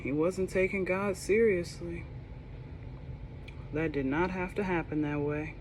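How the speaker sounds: usual speaking voice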